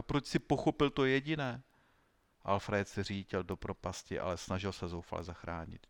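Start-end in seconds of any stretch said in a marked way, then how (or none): 0.0-1.6 raised pitch, lightly anguished; desperate teacher. A narrator's reading. Teacher asking why he understood, desperate. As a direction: wail it a little, pitched up. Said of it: "vztekla zoufalá učitelka".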